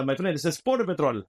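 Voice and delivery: light put-on Slojamistani accent. Uniformed agent answers his friend, in a light Slojamistani accent. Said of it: "I talk like this when I'm in my uniform".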